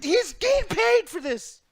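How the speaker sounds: high, slow incredulous whine; high and drawn-out mock outrage; disbelief. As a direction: stretch it out in disbelief. Retold disbelief at commentator.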